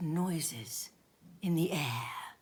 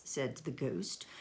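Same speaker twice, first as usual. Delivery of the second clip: usual speaking voice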